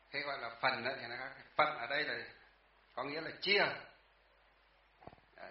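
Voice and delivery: ordinary talk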